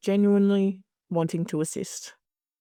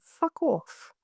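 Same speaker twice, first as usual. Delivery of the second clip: a snappy, higher, dismissive fuck off; dismissive brush-off; 7 semitones higher, 4 dB quieter than usual. Retold or imitated. Telling an asshole in the group to fuck off, dismissive. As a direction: flick the rude member away, higher pitched. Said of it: "I'll be like".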